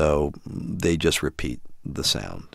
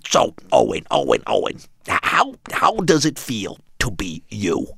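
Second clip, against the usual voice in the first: Gilbert Gottfried voice. Retold or imitated. Father voicing the parrot puppet to his son, Gilbert Gottfried style. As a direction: rasp it out like the cartoon parrot Iago. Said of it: "It's Gilbert Gottfried's voice".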